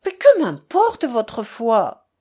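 loud, drawn-out burst of irritation; almost yell it, slowly and forcefully; exasperated near-shout. A narrator's reading. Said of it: "allait crier Miusov". (presque crié, exaspéré)

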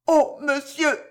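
high, frightened woman; faltering plea. A narrator's reading. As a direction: falter out a desperate appeal. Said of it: "balbutia-t-elle".

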